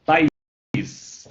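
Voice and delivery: "País" drawn out as pa-ís, the I its own syllable; hiatus split. Shown as a demonstration. Teacher showing a student the split in "País", drawn-out hiatus. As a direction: stretch "País" so the I stands apart from the A. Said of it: "Em país, separa".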